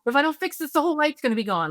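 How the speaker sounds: high, fast, frantic worry; panicked parent. Retold or imitated. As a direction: rush it like the night is slipping away.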